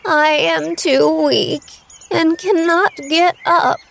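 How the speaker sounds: lightly voiced old granny; old grandmother voice. Weak grandmother speaks.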